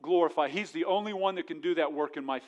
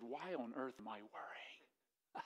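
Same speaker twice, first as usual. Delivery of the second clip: gentle wonder; 6 semitones lower, 21 dB quieter, slower than usual. Retold or imitated. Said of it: "and I said".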